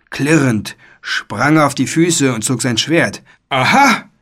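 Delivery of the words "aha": high, loud triumphant exclamation; bawled. A narrator's reading. Knight unmasking a wizard, a high bawled aha. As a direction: bawl it as if catching someone out. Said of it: "gröhlte er".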